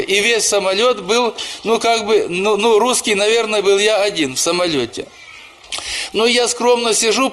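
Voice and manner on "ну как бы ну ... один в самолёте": ordinary talk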